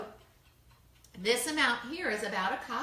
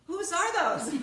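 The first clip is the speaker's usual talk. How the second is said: puzzled; 8 semitones higher, 6 dB louder, slower than usual. Retold or imitated. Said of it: "you think to yourself".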